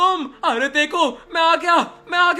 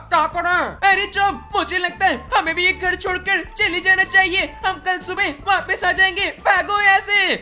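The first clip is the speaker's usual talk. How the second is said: put-on raised child voice, scared and hurried; little boy fleeing ghosts in a hurry; frightened kid voice; 5 semitones higher than usual. Kids running from ghosts and phoning brother, scared.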